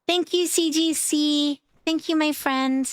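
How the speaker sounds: high, slow gushing thank you. Real, not acted. Streamer thanking a viewer for a gift, high and gushing. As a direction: pour out the thanks, bubbly and high.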